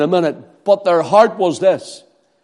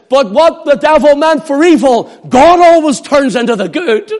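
high, loud proclamation; preaching at full force; 8 semitones higher, 5 dB louder than usual. Acted. Preacher proclaiming to the congregation, loud and high.

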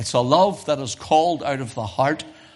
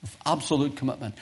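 softer, quicker echo; hushed repeat; 6 dB quieter, faster than usual. Real, not acted. Preacher echoing his own point to the congregation, softly. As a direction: drop the volume and repeat it quickly.

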